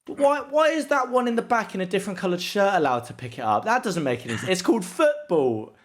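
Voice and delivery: high, baffled mock outrage at the goalie; mock baffled viewer. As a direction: play a clueless newcomer who thinks the rules make no sense. Mock clueless football viewer.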